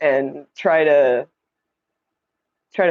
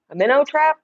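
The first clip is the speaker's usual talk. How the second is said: surprised question; 8 semitones higher than usual. Real, not acted. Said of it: "Somebody told me to get a minnow trap".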